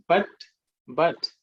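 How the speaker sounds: normal talking voice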